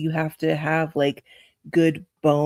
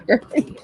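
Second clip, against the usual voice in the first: high laugh; 8 semitones higher than usual. Real, not acted. Laugh after imitation.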